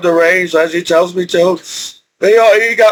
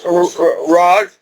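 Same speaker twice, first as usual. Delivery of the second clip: usual speaking voice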